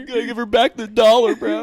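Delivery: very high, loud preteen insisting; shrill twelve-year-old pleading; hammed-up high boy demand. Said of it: "just our voices then".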